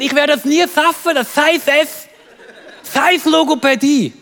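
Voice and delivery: imitated lisp in a cross protest. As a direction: say every S in "schaffen" and "Logopädie" outburst like an F or TH. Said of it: "ein F-Problem".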